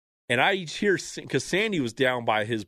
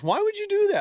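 high, disbelieving question; incredulous; 4 dB louder, faster than usual. Retold or imitated. Course worker reacting to the golf story. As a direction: ask it in total disbelief.

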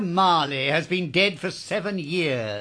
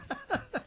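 cold mocking laugh; 10 dB quieter than usual. Scrooge mocks dying poor.

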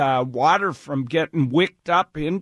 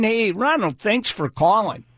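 usual speaking voice

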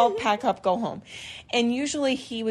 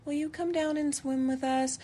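put-on child voice; 6 semitones higher, 5 dB quieter than usual. Son voiced on phone.

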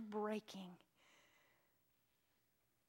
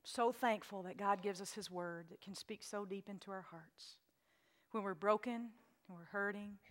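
closing prayer. Acted. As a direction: speak gently over the music.

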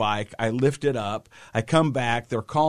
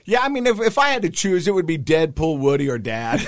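lightly voiced kid, higher and louder; young son; 5 semitones higher, 5 dB louder than usual. Son answering his sister about heroes, lightly mimicked. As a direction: imitate a boy reasoning out his pick.

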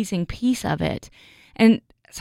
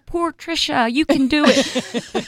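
higher, slow pitying coo; mock pity; 4 semitones higher, slower than usual. Friend quoting her kids' prayer, higher and cooing. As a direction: coo it like a pep talk. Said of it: "she's like".

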